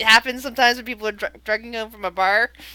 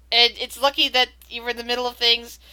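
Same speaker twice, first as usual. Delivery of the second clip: ordinary talk, nothing marked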